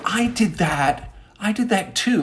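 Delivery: high indignant outburst, stretched words; indignant reaction. Retold or imitated. Storyteller reacting to a viral video, indignant. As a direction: protest that it was you first.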